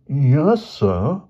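lightly acted nervous reply; nervous stammer. A narrator's reading. Boy answering the headmaster, mildly nervous and high. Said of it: "George stammered".

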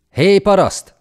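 hangos, magas, lekezelő kiáltás; kiálts oda fölényesen egy parasztnak; gőgös odakiáltás. A narrator's reading. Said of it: "szólította meg a csapat vezére". (fennhéjázó, hangos odaszólás)

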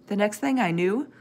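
plain reading voice

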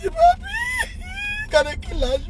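very high, slow, sobbing shout; crying shout. Real, not acted. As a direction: cry out the words through sobs. Crying while quoting.

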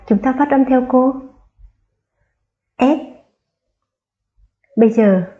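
ordinary talk

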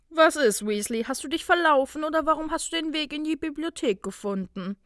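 high, mocking taunt; jeering. A narrator's reading. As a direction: jeer at an unwelcome intruder. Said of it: "höhnte Blaise".

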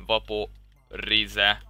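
Vaporize stretched very slowly, each syllable long; slow drawn-out word. Shown as a demonstration. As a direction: draw out Vaporize like a spell. Savoring the spell name.

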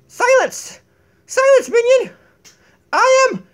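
loud, high royal command; commanding shout. Pretend. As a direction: bark orders at the minion. Queen commands minion.

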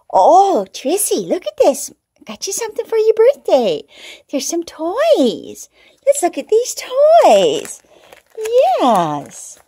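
sing-song voice for a beloved pet; pet talk. Real, not acted. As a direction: coo up high at the birthday kitty. Showing cat its toys.

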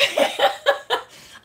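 airy laugh high up; real laugh at her own outburst; high breathy laugh. Real, not acted. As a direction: let a breathy laugh escape. Laughs after cursing at God.

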